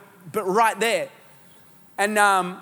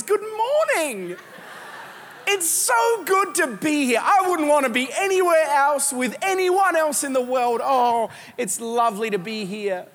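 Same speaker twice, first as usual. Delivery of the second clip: higher, slow, sugary greeting; fake sweetness; 4 semitones higher than usual. Couple arriving at church greets people with fake cheer. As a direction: lay the fake cheer on thick.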